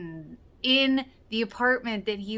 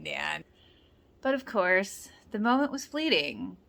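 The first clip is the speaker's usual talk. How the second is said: usual speaking voice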